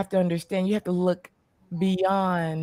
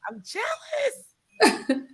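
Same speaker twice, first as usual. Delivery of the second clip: mock jealous wail; 14 semitones higher, 4 dB quieter, much slower than usual.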